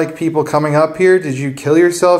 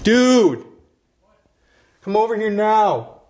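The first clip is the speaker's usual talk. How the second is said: raised voice, calling across rooms; calling from far away; 3 semitones higher than usual. Real, not acted. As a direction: call out to a friend far off. Calling to a friend across the building.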